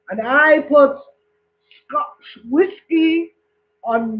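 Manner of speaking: slow, drawn-out Scottish brag; Scottish accent. Acted. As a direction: boast in a put-on Scottish accent, stretching the key words. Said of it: "The Scottish man says".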